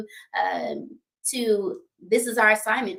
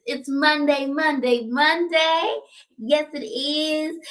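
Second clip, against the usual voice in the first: singsong hype; 6 semitones higher, slower than usual. Real, not acted. Host greeting the live chat, excitedly chanting the day. Host's hype opener on livestream.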